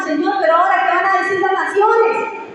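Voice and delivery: high, whiny complaint to God; a hammed-up wail of despair; mock whimpering plea. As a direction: whine like a man sobbing on the floor. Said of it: "Josué tirado en el suelo lloriqueando".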